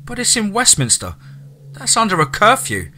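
high, alarmed protest with a gasp. A narrator's reading. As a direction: catch your breath in shock. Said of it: "Abigail gasped".

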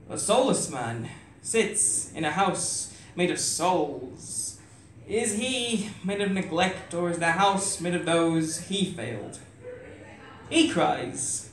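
slow, hushed spoken word delivery; poem recitation. Acted.

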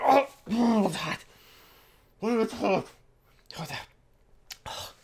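gasping at hot food in mouth; burned tongue. Pretend. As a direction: talk like the bite is scalding. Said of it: "My tongue is burning".

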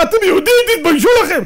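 high, loud rebuke; passing woman scolding brawling fans, high and loud. Retold or imitated. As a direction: shame them sharply. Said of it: "אישה אחת ... אמרה".

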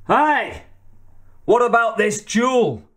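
high, indignant call after someone. A narrator's reading. Fallen MacLeod to opponent walking away, calling out. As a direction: call out high without much extra volume. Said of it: "and called to his opponent".

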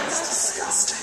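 usual speaking voice

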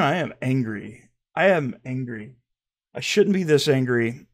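normal talking voice

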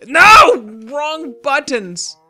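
sudden loud high no; frustrated shout. Real, not acted. Player yelping at a wrong button press, loud.

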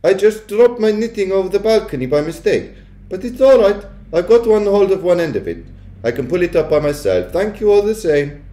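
put-on high, breezy old-lady voice; cool grandmother. A narrator's reading. Grandmother covering up to the witch, coolly. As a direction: chat it high and unbothered. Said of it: "I marvelled at the coolness of her voice".

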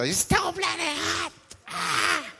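high exasperated cry; hot lady's complaint. Retold or imitated. Sweating lady leaving the freezer, high exasperated wail. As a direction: wail about the heat. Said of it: "The other lady comes out of the freezer".

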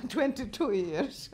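slow, laughing disbelief. Real, not acted. Laughing at how long it's been.

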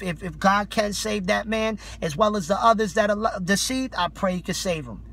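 normal talking voice

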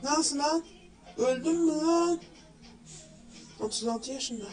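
ordinary talk

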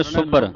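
ਸੁਭਰ as one word, sub-bar; short u and firm doubled b in ਸੁਭਰ; doubled consonant. Shown as a demonstration. Teacher models correct reading, ਸੁਭਰ as sub-bar. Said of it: "ਅਧਿਕ ਲਾ ਕੇ ਉਚਾਰਨ".

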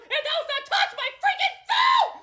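angry shout. Acted. Mickey ends his rant at Donald, outraged yell. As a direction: blow up at him.

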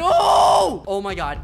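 shocked shout. Real, not acted. Hit while fighting.

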